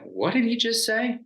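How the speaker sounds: high, startled disbelief; retold disbelief. Student turning to classmates, startled high disbelief. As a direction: pitch it way up in shock. Stunned by teacher's Navy claim.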